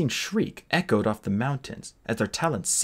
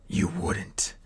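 whispered disbelief; horrified whisper; 4 semitones lower, 3 dB quieter than usual. A narrator's reading. Captive dragon horrified for the egg, whispered. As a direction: breathe it in dread.

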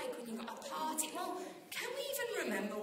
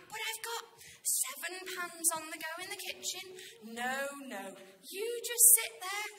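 put-upon hostess; 2 semitones higher than usual. Acted. Martha fuming at guests while she cooks, put-upon. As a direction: play the martyr insisting she can manage. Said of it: "the words coming out of her mouth".

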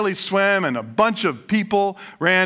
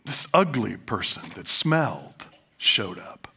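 whispered, lower and softer; hushed telling; 9 semitones lower, 6 dB quieter than usual. A narrator's reading. Preacher tells the congregation of the stranger, whispered. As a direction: draw them in with a secret.

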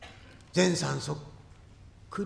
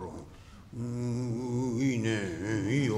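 usual speaking voice

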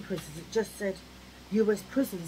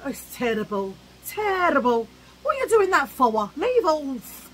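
put-on louder and higher, an old East End nan scolding; old Londoner nan voice; 6 semitones higher, 7 dB louder than usual. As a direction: bark it like a grumpy nan. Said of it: "they all talk like that".

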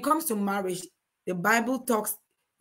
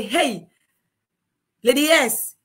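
louder, attention-grabbing call; tattling acquaintance; 3 semitones higher, 5 dB louder, slower than usual. Acted. A gossip calling out to a wife, loud. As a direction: hail her loudly with news to tell. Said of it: "will come one day and say".

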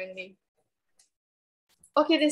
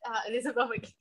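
ordinary talk

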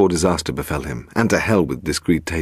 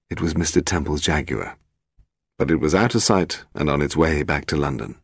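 ordinary delivery, nothing marked

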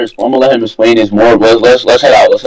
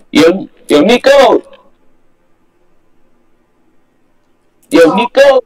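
high shout calling a name; yelling across the room; 7 semitones higher, slower than usual. Real, not acted. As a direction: call out to someone far off. Calling for Nico through house.

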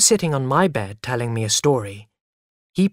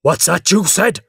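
loud, raised-pitch challenge; shouted; 5 semitones higher, 7 dB louder than usual. A narrator's reading. Father jumping out of bed, shouting. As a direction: thunder it as an affronted father. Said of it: "shouted Father".

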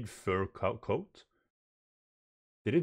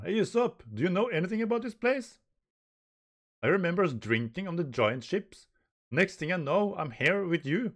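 high, lively acted dialogue; comic manga characters; 8 semitones higher, 4 dB louder than usual. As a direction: play the confused crew, pitched up.

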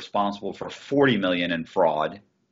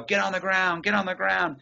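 higher-pitched mock yell of a police order; retold police yell; 9 semitones higher than usual. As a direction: imitate agents barking orders at him, not full volume. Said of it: "They're screaming".

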